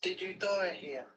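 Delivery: breathy whisper, slow; whispered to a ghost. Real, not acted. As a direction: whisper it slowly into the dark. Repeats question whispered to spirit.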